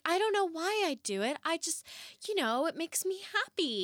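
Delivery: high-pitched, imitated protest; defensive arguing. Wife answers her arguing husband, imitated high defensive protest. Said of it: "Yelling at her and arguing".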